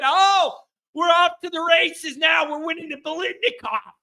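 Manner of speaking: high, shouted mock celebration; mock hype. Host mocking overreaction to a spring clip, mock hype.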